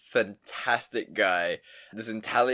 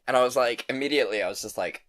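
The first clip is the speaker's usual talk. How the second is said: ordinary talk, nothing marked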